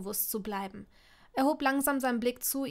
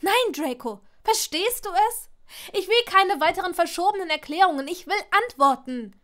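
loud, high, angry insistence; exasperated demand; 7 semitones higher, 9 dB louder than usual. A narrator's reading. Hermine demands answers from Draco, loud and exasperated. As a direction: demand it at the end of your patience. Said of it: "als würde ihr Brust platzen".

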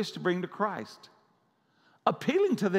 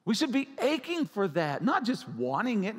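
normal talking voice

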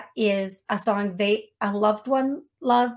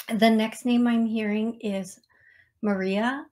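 ordinary talk

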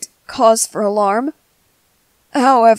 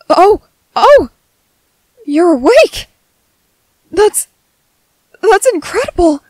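higher, louder burst of amazed relief; astonished relief; 7 semitones higher, 7 dB louder than usual. Acted. Medic sees wounded patient awake, astonished relief. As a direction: exclaim it in startled joy.

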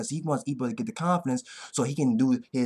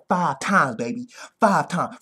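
ordinary talk, nothing marked

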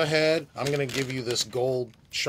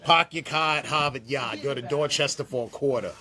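r-dropping Boston accent. Shown as a demonstration. Host joins in the guest's demo with a Boston accent. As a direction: drop every r like a Bostonian. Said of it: "That's Boston, right?".

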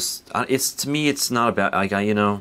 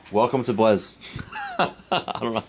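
stifled laugh; 4 semitones higher than usual. Real, not acted. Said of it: "I can't say that with a straight face".

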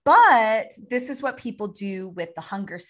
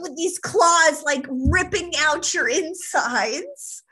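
slow menacing; 5 semitones higher, 5 dB louder, slower than usual. Shown as a demonstration. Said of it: "I'm not a gnome inside going".

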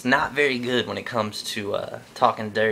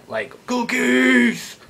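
much higher, slow, drawn-out monster yell of cookies; slow monster roar of cookies; 13 semitones higher, 4 dB louder, much slower than usual. Retold or imitated. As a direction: bellow cookies like a greedy puppet monster. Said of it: "I'm the cookie monster".

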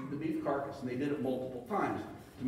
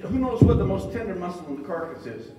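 usual speaking voice

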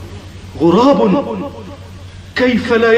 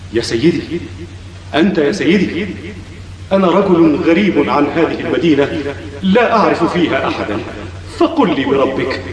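pleading; 8 semitones lower than usual. Acted. Pleading with a silent old man.

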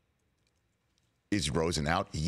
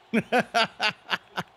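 high loud laugh; burst of laughter; 12 semitones higher, 5 dB louder than usual. Real, not acted. Host cracking up over the quote, loud laughter.

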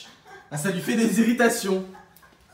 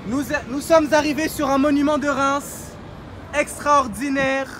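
higher, put-on Quebecois accent; lightly mock Quebecois accent, slowing down; 6 semitones higher than usual. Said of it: "Je parle avec un accent québécois".

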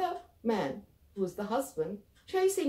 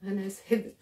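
ordinary delivery, nothing marked

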